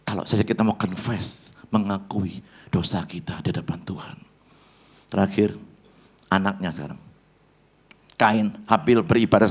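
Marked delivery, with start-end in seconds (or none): 0.1-4.2 dropping to a near whisper; hushed appeal. Acted. Preacher urging the congregation to confess, almost whispering. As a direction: draw them close with a hushed plea. Intimate altar-call appeal.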